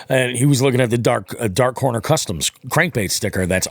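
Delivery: ordinary talk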